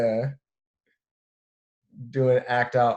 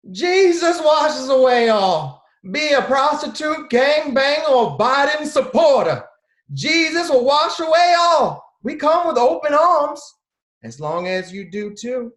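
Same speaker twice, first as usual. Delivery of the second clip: scary born-again aunt; 11 semitones higher, 5 dB louder than usual. Retold or imitated. Born-again aunt preaching to sinners in a high, loud voice. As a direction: preach forgiveness like a zealous aunt.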